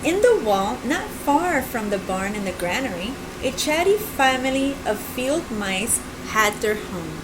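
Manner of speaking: put-on gentle read-aloud for kids; storybook narration. A narrator's reading. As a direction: read it like a picture book.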